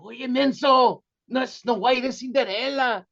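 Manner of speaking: imitating family scolding a cousin; mock yelling relatives. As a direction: shout him down in Spanish. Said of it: "they're all yelling at him".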